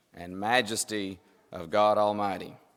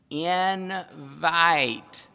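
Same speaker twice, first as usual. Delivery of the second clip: slow, drawn-out words, pitched up; mock talking to foreigners; 4 semitones higher, much slower than usual. Preacher imitates himself inviting a non-English speaker, exaggeratedly slow. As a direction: stretch each word as if that makes English understandable. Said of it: "you tend to go like this".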